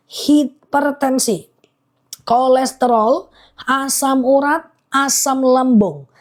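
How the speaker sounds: slow, careful listing, one item at a time; slowly. Real, not acted. Honey seller slowly repeating the ailments list to viewers. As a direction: read the list slowly so everyone catches it. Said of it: "pelan-pelan".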